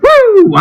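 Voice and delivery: sudden high whoop of relief; excited whoop. Real, not acted. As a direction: let out a big happy woo. Sudden whoop after tech trouble.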